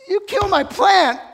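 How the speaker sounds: high, loud shouted accusation on "plant"; shouted, high-pitched fury; furious shout. Acted. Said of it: "watch Jonah's response".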